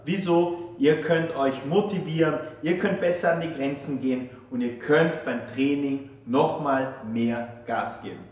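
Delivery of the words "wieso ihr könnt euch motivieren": ordinary talk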